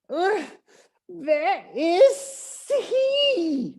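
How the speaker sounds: high, drawn-out snake voice hissing in anger; angry serpent hissing. A narrator's reading. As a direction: hiss it out like a furious snake. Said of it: "hissed the snake angrily".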